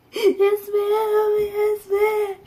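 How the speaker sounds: high, whimpering tearful voice; mock crying. Child answering mom after being told no, mock tearful whimper. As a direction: act out a pitiful child's sob. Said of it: "so I start crying".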